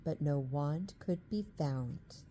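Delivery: ordinary delivery, nothing marked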